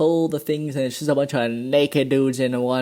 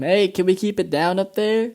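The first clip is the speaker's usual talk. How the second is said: raised, higher calling voice; mock yell upstairs; 6 semitones higher, 3 dB louder than usual. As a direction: call up to a noisy neighbour, half-hearted yell. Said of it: "he yells up to him".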